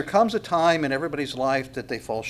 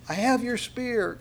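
mock calling out; 8 semitones higher, slower than usual. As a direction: imitate someone hollering far off, slowly. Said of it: "yelling out to him".

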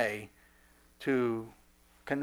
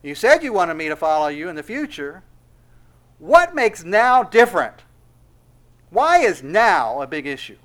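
louder, emphatic questioning of a teacher; insistent challenge; 5 semitones higher, 11 dB louder than usual. Acted. Peter challenging Jesus over why now is different, loud and insistent. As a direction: press the questions loudly, leaning on key words.

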